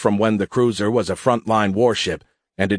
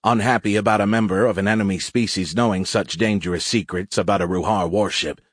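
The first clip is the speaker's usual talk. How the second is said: usual speaking voice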